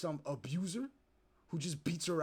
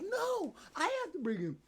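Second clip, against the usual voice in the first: very high-pitched imitation of yelling; mock yelling; 13 semitones higher than usual. Streamer imitates the mother refusing the dad, mock high yelling. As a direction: mimic her yelled refusal, shooting way up high. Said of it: "And yelling at him".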